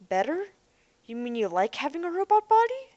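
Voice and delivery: taken aback, voice jumping up; shocked. A narrator's reading. Girl questioning the robot boy's choice, shocked.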